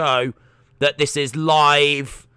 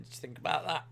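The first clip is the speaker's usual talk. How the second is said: soft aside; 14 dB quieter, much faster than usual. Acted. Teasing Austin about the message.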